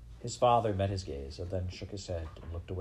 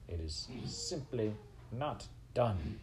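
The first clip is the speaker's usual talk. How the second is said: slow, softer, pronounced disapproval; disapproving aunt; 2 semitones higher, 5 dB quieter, slower than usual. A narrator's reading. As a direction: draw out each word like a scandalized aunt. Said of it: "came the distinctive voice of Despereaux's Aunt Florence".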